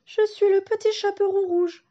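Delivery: put-on high little girl's timid voice; a small girl shaking with fear; trembling. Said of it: "en tremblant".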